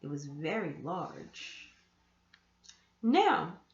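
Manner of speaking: ordinary delivery, nothing marked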